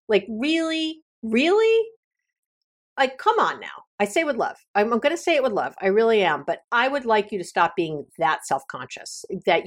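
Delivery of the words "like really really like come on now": incredulous. Real, not acted. Indignant at friends' photo requests.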